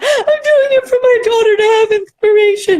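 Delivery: high, loud, laughing through tears; tearful. Retold or imitated. Crying on live, answering her friend's pep talk, high and tearful. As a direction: blurt it out half crying, half laughing.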